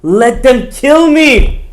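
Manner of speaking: high, loud, slow anguished plea; desperate crying plea. Retold or imitated. Yosef at his mother's grave, a high loud anguished plea. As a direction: wail to your mother at her grave. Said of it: "he said".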